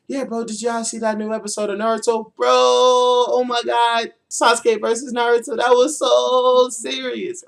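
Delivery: high, loud, stretched-out gushing; nerdy excited fanboy. Retold or imitated. Freshman gushing to his nerdy friends about Naruto, high and stretched. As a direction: gush like a kid who just saw the best episode. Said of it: "I'll be like".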